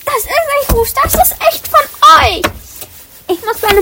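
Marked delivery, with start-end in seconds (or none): 0.0-2.4 high, offended protest; protest, hurt and offended; indignant protest. Acted.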